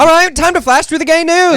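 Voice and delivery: high, loud show-host call; playful segment announcer. Pretend.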